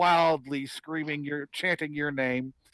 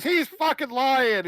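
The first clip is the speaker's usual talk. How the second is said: slow, higher, louder outburst; angry accusation; 8 semitones higher, 5 dB louder, slower than usual. Acted. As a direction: call out the liar in front of everyone. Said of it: "Gwildor comes up and goes".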